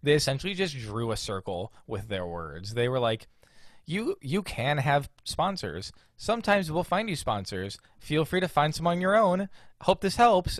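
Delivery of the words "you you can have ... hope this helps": high, sing-song fake helpfulness; mocking email voice. Retelling the company's circular email, mock-cheerful and high. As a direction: recite it high, getting louder toward the sign-off.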